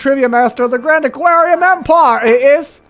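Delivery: high, loud, hammed-up would-be southern accent; exaggerated accent. Trivia master introduces himself after the accent dial turns, exaggerated accent. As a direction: put on a big, silly, high-pitched accent. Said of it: "I'll take on a southern accent".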